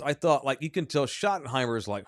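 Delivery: ordinary talk, nothing marked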